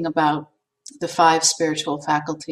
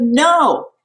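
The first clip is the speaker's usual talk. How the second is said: high, loud mock shout; read-aloud shout; 8 semitones higher, 7 dB louder than usual. Reading the poem aloud to class, a loud mock shout. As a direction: shout it out in panic. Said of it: "I shouted".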